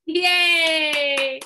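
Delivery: loud, high, drawn-out yay; happy cheer. Real, not acted. Cheers at a listener's yes.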